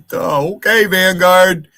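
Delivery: hammed-up dimwit; mock dumb voice. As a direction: play a dimwit for a laugh. Mocking a dim-witted chatter.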